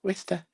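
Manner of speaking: Wista said high and soft, WIS-ta; mimicking pronunciation. Shown as a demonstration. Host echoes Wista again, in a mock high voice. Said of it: "correct pronunciation".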